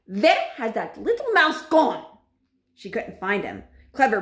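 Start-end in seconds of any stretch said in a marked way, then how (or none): 0.1-2.0 slow, high, loud questioning; shouting witch. A narrator's reading. Grand High Witch hunting the mouse, shouting. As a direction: demand it loudly, word by word. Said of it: "shouted the Grand High Witch".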